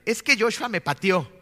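lightly voiced tattling little boy; Yetro. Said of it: "Dice Yetro, el grande". (queja de niño, levemente imitada)

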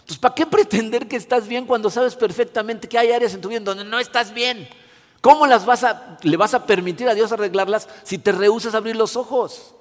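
ordinary talk, nothing marked

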